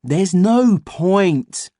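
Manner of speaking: put-on very slow, high boy voice; boy character voice. A narrator's reading. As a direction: drag it out, bored and sure. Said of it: "said Ian".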